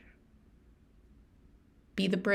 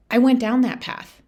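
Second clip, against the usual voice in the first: normal talking voice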